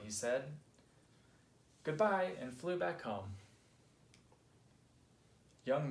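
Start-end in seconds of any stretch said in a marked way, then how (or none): 1.8-2.3 high, loud farewell; calling goodbye. A narrator's reading. Marty leaves Finn to fly home, calling goodbye. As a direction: call it out on parting.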